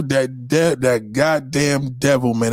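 ordinary talk, nothing marked

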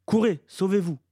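high, loud warning shout; cried out. A narrator's reading. Mother warns the children to run, crying out. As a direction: yell an urgent order to flee. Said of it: "s'écria-t-elle".

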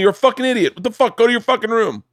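imitating his father yelling at him as a kid; imitating angry dad. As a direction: bark like a strict dad. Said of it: "My dad would curse at me".

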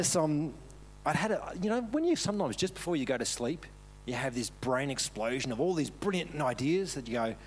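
normal talking voice

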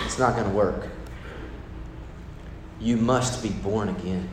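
soft, low, steady verdict; a hint of calm Jesus; quiet firm Jesus. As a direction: say it quietly and firmly. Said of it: "Jesus looks at him and goes".

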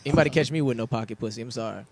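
ordinary talk, nothing marked

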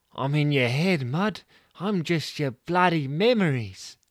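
lightly voiced Jen, teasing; Jen's voice. Jen answers in her head.

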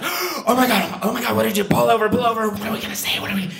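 high frantic hushed panic; panicked whisper. Retold or imitated. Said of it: "you whisper to each other when that happens".

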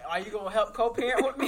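mock plea through laughter; laughing. Mocking a character's plea.

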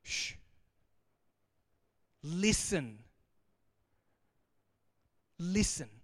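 lightly voiced booming voice from heaven; weighty hushing command, with long pauses; God. God interrupts busy Peter from heaven, forceful and measured. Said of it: "It's a booming voice from the heaven".

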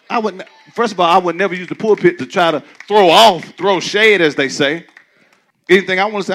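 loud rising preacher call and answer; preaching at full force. Acted. Preacher to congregation, loud call and response.

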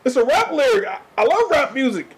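squeaky-high stammered excuse, mocking. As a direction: imitate someone fumbling for an excuse. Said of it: "they're gonna think that they can say it too".

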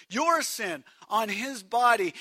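ordinary talk

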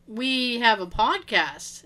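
slow, measured retold answer; slow explanation. Friend explains himself to the head librarian, slowly. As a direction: spell it out slowly, at normal volume.

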